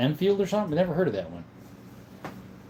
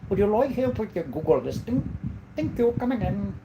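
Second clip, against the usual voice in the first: higher-pitched, put-on Indian accent; mock Indian accent; 6 semitones higher than usual. Showing off accents with a Google listing offer, mock Indian accent. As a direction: imitate an Indian-accented call-center agent. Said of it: "other times we sound like".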